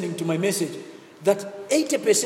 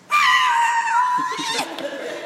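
loud laugh; 4 dB louder than usual. Real, not acted. Preacher laughs loudly.

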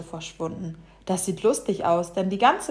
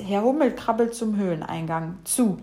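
ordinary delivery, nothing marked

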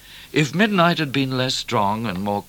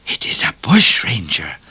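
urgent hissing whisper; 6 dB louder than usual. A narrator's reading. Cat warning the others of a bushranger, whispered. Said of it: "whispered Cat".

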